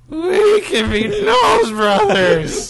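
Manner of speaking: high, loud, slow and laughing Charlie voice; Charlie impression. Shown as a demonstration. [name] imitating Charlie from Subcultured, high, loud, laughing. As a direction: stretch out Nose Brothers in a squealing cartoon yell. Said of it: "[name] imitating Charlie from Subcultured".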